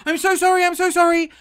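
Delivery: high, loud pleading; desperate apology to the frightened driver; frantic apology. Retold or imitated. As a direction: cry out the apology.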